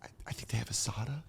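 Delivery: fast hushed whisper; whispering about the taco menu at a sad party. Retold or imitated. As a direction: whisper quickly like a secret. Whispered food talk at sad party.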